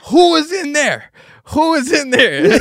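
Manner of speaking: high, slow imitated girl asking; the girl's voice. Party girl demanding who used the bathroom, high and slow. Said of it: "the girl was like".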